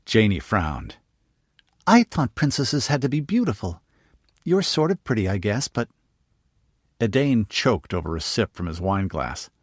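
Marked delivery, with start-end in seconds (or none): 1.9-5.9 put-on high, eager little girl; young girl voice. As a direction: pitch it up like a curious young girl. Narrator voices young girl Jane.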